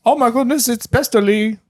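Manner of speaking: put-on high, gushing British accent. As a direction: squeal it in a fake British accent. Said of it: "Sure he wasn't Boston?".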